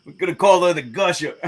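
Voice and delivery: high, fast, loud, breaking into laughter; laughing. Real, not acted. Painter names the runny paint, laughing. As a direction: crack up while saying it. Laughing at own dirty joke.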